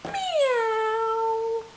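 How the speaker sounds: long, high drawn-out meow; kitty meow. Pretend. Mother playing the kitty for her toddler, long high meow. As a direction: stretch it like a calling cat. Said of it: "that's a kitty cat".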